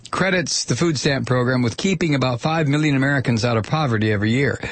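normal talking voice

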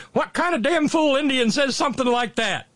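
high, loud incredulous character voice; old chief's scoff. Retold or imitated. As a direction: bellow it like a scornful old chief in a movie. Voicing the film's old chief.

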